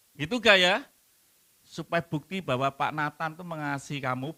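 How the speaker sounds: ordinary talk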